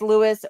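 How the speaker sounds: Louis with the final S sounded, LOO-iss; pronunciation option. Shown as a demonstration. Unsure streamer trying a viewer's name, Louis said as LOO-iss. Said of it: "how to pronounce your name".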